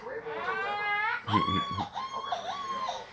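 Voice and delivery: a laugh breaking into a sob, high and strained; choked laugh-sob. Real, not acted. Father looking at his kids' photo, laughing through tears.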